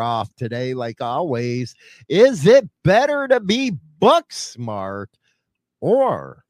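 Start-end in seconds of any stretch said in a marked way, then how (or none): 2.1-6.4 louder, higher, drawn-out question; topic announcement. Real, not acted. Host posing the debate to listeners, punchy and drawn-out. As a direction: tease the topic. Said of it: "host announcing the topic".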